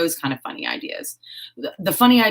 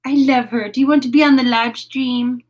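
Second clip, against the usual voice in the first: high, louder cooing; adoring pet talk; 5 semitones higher, 4 dB louder than usual. Real, not acted. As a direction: coax a pet sweetly in a raised voice. Coos at her beloved animal.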